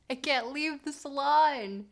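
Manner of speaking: ordinary talk, nothing marked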